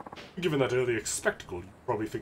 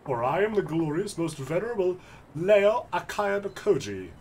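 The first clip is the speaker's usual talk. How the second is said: grand self-introduction; 6 semitones higher, 3 dB louder than usual. Acted. Nobleman grandly announces his name.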